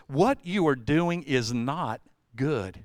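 slow, measured warning; slow, grave. Retold or imitated. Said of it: "He goes".

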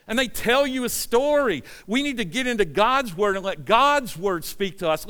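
high, rising preacher's plea; preaching at full force. Real, not acted. Pastor urging the church back to the Word, fervent and loud. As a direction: drive it home to the church.

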